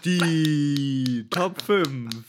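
loud, slow announcer intro. Pretend. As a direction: boom it out like a show intro.

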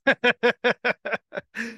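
a real, warm chuckle; real laugh. Real, not acted. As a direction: laugh out loud at your own joke. Host laughs at own car joke.

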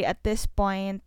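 ordinary talk

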